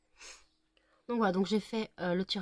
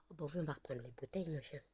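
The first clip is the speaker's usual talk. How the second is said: much softer, whispered fond teasing; 3 semitones lower, 12 dB quieter, faster than usual. Real, not acted. An owner whispers fondly to her chihuahua, much softer. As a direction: murmur it close to the dog's ear.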